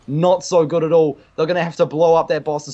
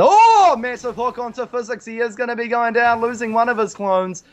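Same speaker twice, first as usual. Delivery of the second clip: excited; 7 semitones higher than usual. Real, not acted. Big hook in live cast.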